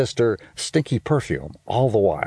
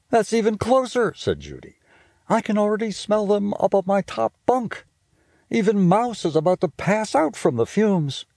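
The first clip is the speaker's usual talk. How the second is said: high, quick bossy big-sister voice; a know-it-all sister teasing her brother; Judy's voice; 10 semitones higher than usual. Acted. Big sister scolding little brother about smell, high and bossy. Judy speaking in story.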